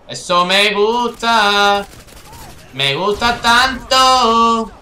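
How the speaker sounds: very slow, loud and high; drawn-out cheer. Real, not acted. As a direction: stretch every word in delight.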